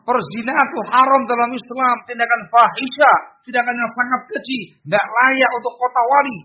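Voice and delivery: high, forceful condemnation; demonstrated indignant preaching; high angry tone. Shown as a demonstration. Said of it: "Nadanya tinggi".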